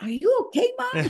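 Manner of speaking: put-on very high child's voice; kids asking. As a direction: sound like a puzzled kid. Kids voiced in her story.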